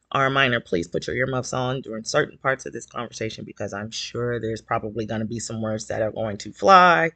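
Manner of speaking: ordinary talk, nothing marked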